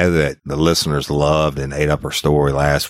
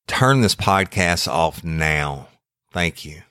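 ominous warning; 4 semitones higher than usual. Acted. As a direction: deliver it like a solemn warning. Disclaimer read before the show.

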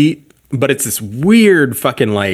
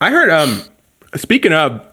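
mouth full; 5 semitones higher than usual. Real, not acted. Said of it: "I got some shit in my mouth".

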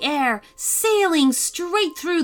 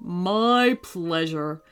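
gentle; 4 semitones lower, much slower than usual. A narrator's reading. Frank answers gently.

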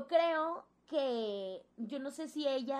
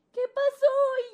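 high, louder, slow worried question; concerned mentor; 11 dB louder, much slower than usual. Retold or imitated. Mentor at the door asks what happened, high and slow. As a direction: ask what happened, drawn out. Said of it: "Y me dice".

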